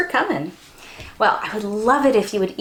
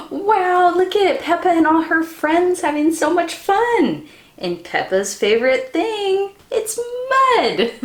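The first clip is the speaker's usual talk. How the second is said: excited; 7 semitones higher than usual. Real, not acted. Excited reading about mud fun.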